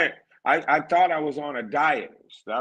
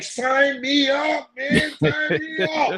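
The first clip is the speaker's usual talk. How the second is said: slow, high, drawn-out eager request; eager mock plea; 13 semitones higher, slower than usual. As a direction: savor each word like a dream come true. Eager to join college.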